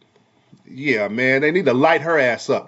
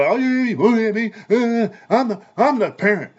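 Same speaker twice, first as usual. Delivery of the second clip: higher, put-on voice of a snappish parent; mock yelling parent; 7 semitones higher than usual. Mocking a parent who yells at their moody child, higher put-on voice. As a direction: parody the parent who snaps back at a moody kid. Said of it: "Don't just yell".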